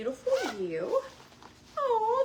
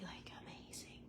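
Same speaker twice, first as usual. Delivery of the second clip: much softer, unvoiced; awed whisper; 17 dB quieter than usual. Real, not acted. Admiring the sunglasses, an awed whisper. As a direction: breathe it out in awe.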